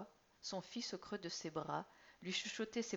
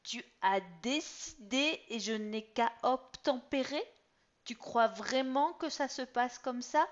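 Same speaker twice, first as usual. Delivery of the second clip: syllables held back; 6 semitones higher, 10 dB louder than usual. Acted. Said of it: "en détachant chaque syllabe pour ne pas crier".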